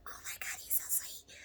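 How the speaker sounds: hushed, gushing aside; smitten whisper. Retold or imitated. Patient swooning to herself over humble doctor, smitten whisper. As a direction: whisper it like a secret crush.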